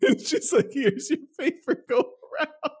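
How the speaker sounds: high, slow, laughing through it; laughing while telling. Retold or imitated. Retelling the nurse's reply while laughing hard. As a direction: giggle through the nurse's words.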